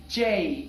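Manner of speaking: جے with the gliding "ey-ee" vowel; correct English J. Shown as a demonstration. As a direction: say جے drawn out, rhyming with English A. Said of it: "اب جیسے آپ نے اے پروناؤنس کیا".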